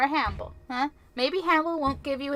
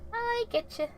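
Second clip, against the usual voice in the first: much higher little voice; high-pitched; 12 semitones higher than usual. Real, not acted. Player repeating an acknowledgement, high-pitched. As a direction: lift it way up.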